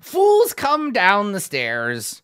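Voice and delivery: high, slow, loud goblin; goblin Yek. Acted. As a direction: drag it out like a scornful goblin.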